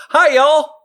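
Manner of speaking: high, loud "y'all" greeting; big mock-Texan hello. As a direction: holler a playful Southern hello.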